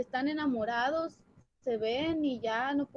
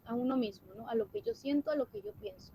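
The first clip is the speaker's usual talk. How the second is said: ordinary talk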